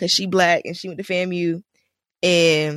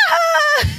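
imitating Crystal's laugh; 5 dB louder than usual. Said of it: "She be like".

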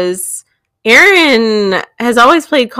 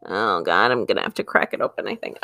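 dreading mutter; 12 semitones lower, 13 dB quieter, faster than usual. Real, not acted. Dreading eating the pickle.

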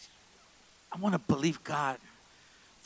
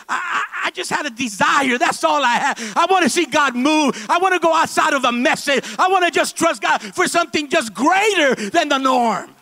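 loud, much higher preacher's cry of longing; full-force preaching; 12 semitones higher, 10 dB louder than usual. Real, not acted. Pastor crying out to the congregation at full preaching force. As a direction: pour out the desire at the top of the voice.

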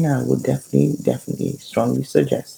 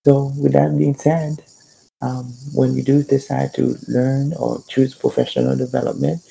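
ordinary talk

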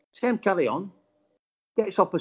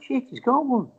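higher, startled realisation; 10 semitones higher than usual. Retold or imitated. Watcher spots the other angler's catch, startled. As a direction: blurt it in sudden surprise.

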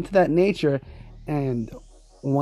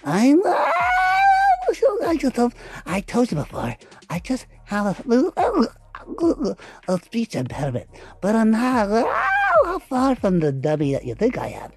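squeaky goofy voice with high shrieks; Clevis character voice; 7 semitones higher than usual. Acted. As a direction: play a dim-sounding oddball, pitch leaping. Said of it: "what Clevis is supposed to just say".

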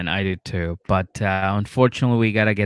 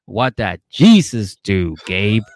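slow, louder demand; pressing dad; 8 dB louder, slower than usual. Retold or imitated. Dad grilling Gabe about Jesus, slow and loud. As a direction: lean on him slowly.

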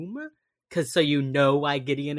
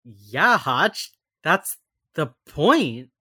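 exasperated; 7 dB louder, much slower than usual. Retold or imitated. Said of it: "I just wanted to be like".

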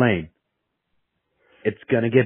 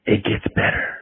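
breathy whisper close to the ear; 4 semitones lower than usual. Retold or imitated. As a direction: whisper it like a secret promise. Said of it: "he just whispered in your ear".

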